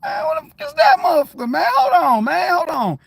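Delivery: very high, loud alarmed yell; hammed-up panicked shout. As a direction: freak out at a stranger coming close. Acts out alarmed reaction.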